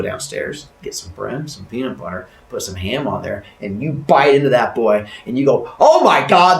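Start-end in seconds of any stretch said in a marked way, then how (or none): 5.8-6.6 loud, raised cry of revulsion; mock disgust. Kid biting into awful sandwich, loud mock disgust. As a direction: exclaim it in exaggerated disgust.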